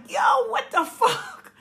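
high, drawn-out cry of disbelief with a laugh; incredulous shout. Retold or imitated. Kids to mother walking home, incredulous shout. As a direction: reenact a baffled outcry, laughing through it. Retold outraged call to mother.

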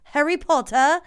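a hint of put-on British; mock British accent. Podcaster mocking the fake Brit's Harry Potter talk, mock British accent. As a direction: lightly mimic a British accent. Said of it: "started talking like Harry Potter".

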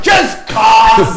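high, loud petulant shout; hammed-up yell refusing to explain; mock shout. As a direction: snap it back like a stubborn jerk. Retold bar argument.

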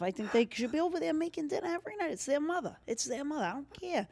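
high, quick, hushed fussing; indignant complaint. Pretend. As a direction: fuss about it in a high, hurried voice. Acts out indignant complaining relative.

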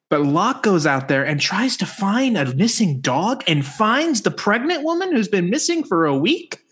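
rising, singsong emphasis, climbing high by the end; incredulous pitch. Real, not acted. Host persuading co-host about Hottie of the Week, incredulous. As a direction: build to a high, disbelieving finish.

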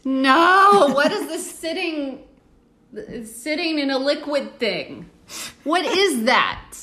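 high, loud disgusted outcry; disgusted shock. Real, not acted. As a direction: recoil in disgust at the food. Disgusted reaction to recipe.

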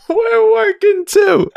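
high, slow mocking through laughter; laughing. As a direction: squeak it out while laughing.

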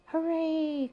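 high, loud hooray; excited cheer. A narrator's reading. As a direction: cry out in delight. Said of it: "cried Arthur".

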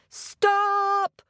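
high, sharp shout to halt a tiger; shouted command. A narrator's reading. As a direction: cry out a firm order. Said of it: "shouted Ted".

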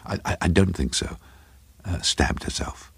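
halting, stammered undertone sinking to a whisper; hesitant. A narrator's reading. Craven answering a stranger during the film, hesitant and hushed. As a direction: answer unsurely under your breath.

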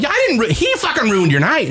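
high, indignant protest; exasperated, voice going up; angry retelling. Retold or imitated. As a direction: re-enact throwing the blame back. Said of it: "I'm angry".

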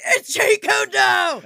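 high, loud, drawn-out mock shout; imitated yelling. One guy yelling the answer at the podcast, imitated shout. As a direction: yell it slowly like an exasperated listener. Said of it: "I know someone was yelling at me".

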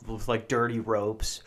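normal talking voice

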